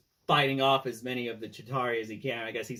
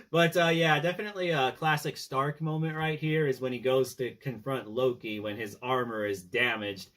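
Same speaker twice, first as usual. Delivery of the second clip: ordinary talk, nothing marked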